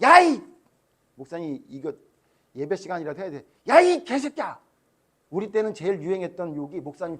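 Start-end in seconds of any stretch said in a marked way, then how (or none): 0.0-0.4 loud, high angry outburst; angry swearing. Retold or imitated. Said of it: "욕을 해, 욕을 해". 3.7-4.6 loud high mock cursing; swearing shout. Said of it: "욕을 해, 욕을 해".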